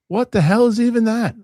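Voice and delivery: high, loud outraged old-man impression; mock-indignant high-pitched yell; clip imitation. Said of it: "You ever see that clip".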